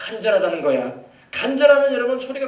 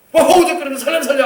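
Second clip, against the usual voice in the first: loud, high acted drowning yell; raised, desperate mock plea; urgent cry for help; 6 semitones higher, 8 dB louder than usual. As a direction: shout for rescue as if going under. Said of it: "하지 않습니까".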